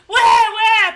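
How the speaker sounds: high, loud panicked squeal; a baby voiced as a shrill panicked yell; baby's panicked cry. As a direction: shriek it like a frightened baby. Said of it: "The baby's like".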